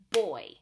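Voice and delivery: put-on "boy" said loud and punched as one clapped beat; clapped-out word. Shown as a demonstration. Teacher showing parents how to clap out "boy", loud. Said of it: "one syllable in the word boy".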